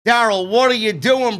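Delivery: ordinary delivery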